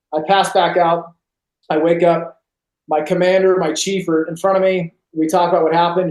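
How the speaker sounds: ordinary talk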